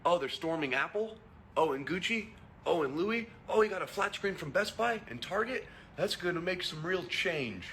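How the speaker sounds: high, sing-song fake amazement; sarcastic mock wonder. Ridiculing looters of Apple and Gucci, high sarcastic wonder. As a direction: ham up a dim bystander marvelling at looting. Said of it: "How much dumber does it sound when you're like".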